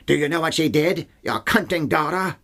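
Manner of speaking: imitating Burke's British accent badly. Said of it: "say in Burke's accent".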